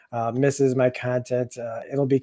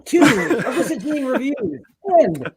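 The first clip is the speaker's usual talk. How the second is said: hammed-up plug; 11 semitones higher, 7 dB louder than usual. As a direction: ham it up with big swooping pitch and stops.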